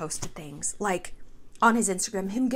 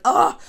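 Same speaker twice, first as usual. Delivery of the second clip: loud groan of disgust; disgusted ugh; 4 semitones higher, 8 dB louder than usual. Retold or imitated. Reacting to old cheating resurfacing with a loud disgusted ugh. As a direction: groan like you can't stand it.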